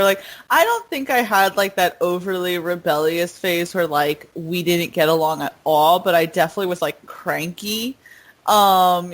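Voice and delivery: usual speaking voice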